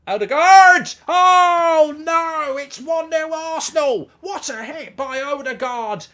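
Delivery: high, loud, drawn-out commentary yell; astonished football watch-along roar; real goal shout. Real, not acted. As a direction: erupt at the goal, stretching out the words. Live reaction to Arsenal scoring.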